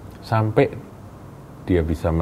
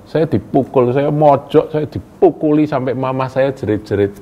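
much higher, louder, in another voice; retelling being beaten; 8 semitones higher, 4 dB louder than usual. Retold or imitated. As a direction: voice the friend reliving the beating. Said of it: "dia bilang gitu ya".

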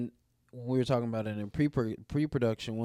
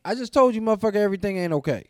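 much higher, louder and fast, fed up; hammed-up exasperated yell; exasperated outburst; 9 semitones higher, 8 dB louder, much faster than usual. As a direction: snap back in mock fury. Exasperated retort to repeated question.

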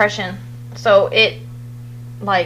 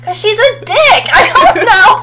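high, loud, breaking into a laugh; exasperated laughing retort; 12 semitones higher, 6 dB louder than usual. Retold or imitated. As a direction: blurt it and crack up. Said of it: "And I was like".

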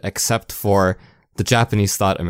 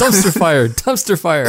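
higher and louder, savouring each word; gleeful echo; 9 semitones higher, 4 dB louder than usual. Acted. Co-host gleefully echoing the host's phrase, higher and louder. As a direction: repeat it like it's the best joke ever.

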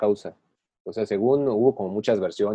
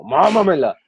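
hammed-up demon voice, higher and louder; demonic whisper; 5 semitones higher, 5 dB louder than usual. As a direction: growl it like a possessed girl. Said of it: "Así como".

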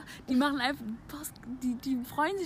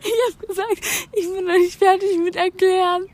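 excited protest; 7 semitones higher, 8 dB louder than usual. Real, not acted. Laughing protest at friend.

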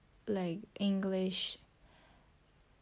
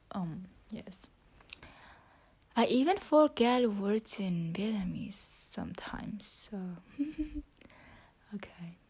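whispering. Real, not acted. Whispering her English practice into the phone. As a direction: keep it to a whisper throughout.

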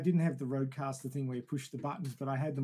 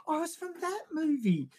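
realizing; 13 semitones higher than usual. Retold or imitated. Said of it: "I'm going".

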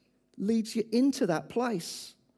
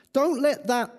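ordinary talk, nothing marked

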